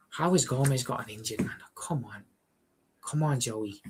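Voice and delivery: exasperated whisper at the screen; frustrated whisper. Real, not acted. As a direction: whisper it in disbelief. Frustrated at player injury on stream.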